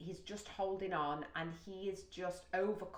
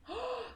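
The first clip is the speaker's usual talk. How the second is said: high, sudden gasp; excited gasp. Real, not acted. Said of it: "I got really excited".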